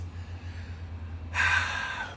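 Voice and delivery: long unvoiced sigh. Real, not acted. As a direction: let out a long breath. After the cold plunge.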